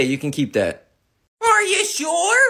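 ordinary talk

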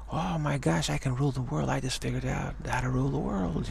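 put-on high, excited child's voice; a kid bragging he can rule the world, high and excited. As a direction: gush like a kid who just found a secret. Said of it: "a kid saying".

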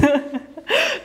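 short real laugh at wobbling; breathy laugh while off balance. Real, not acted. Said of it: "I'm losing my balance".

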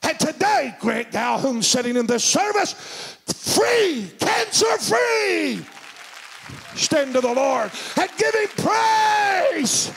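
high, driving preacher's cry; full-force preaching. Real, not acted. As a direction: build to long, high shouted praise words.

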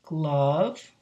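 ordinary delivery

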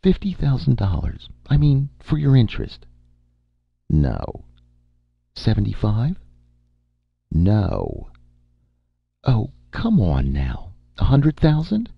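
woman upping her offer, pitch climbing to a high plea; Amy, bargaining. Acted. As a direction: wheedle with rising bids.

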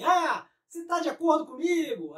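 mock lecturer quizzing the audience; speaker asking the audience. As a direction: play a speaker asking the crowd to agree. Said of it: "aí você fala assim".

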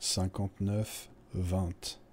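drawn-out, careful number in pairs; slow repeat. Acted. Man asked to repeat his number, slow and paired. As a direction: say the number slowly in two chunks. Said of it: "vous pouvez le redire".

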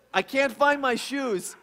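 put-on a child complaining she can't find her shoes; irritated child. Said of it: "then she gets irritated".

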